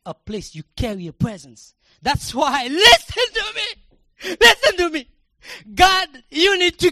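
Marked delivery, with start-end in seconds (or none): 2.0-2.7 loud, high fervent preaching shout; preaching at full force. Acted. 2.7-5.1 high, loud, drawn-out shout; repeated pulpit cry; shouted call. Acted.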